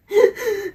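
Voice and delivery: high mock sob between laughs; mock sobbing burst. Retelling crying on Annie's chest, a high laughing mock sob. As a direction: imitate your own wailing, laughing. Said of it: "And I was like".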